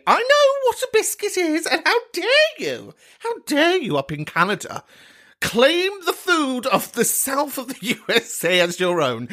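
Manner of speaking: high, camp how dare you; mock outrage. Host scolding the Canadian caller, mock indignant diva. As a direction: play wounded diva offended by the caller.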